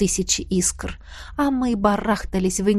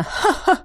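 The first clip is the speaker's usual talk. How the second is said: cold, crazed high cackle; hysterical witch laugh; 9 semitones higher than usual. Acted. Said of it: "Сумасшедший хохот ... Холодный и истеричный".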